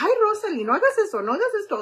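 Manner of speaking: high, loud scolding Spanish mom voice; nagging mom. Retold or imitated. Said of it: "My mom's always like".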